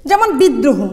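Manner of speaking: usual speaking voice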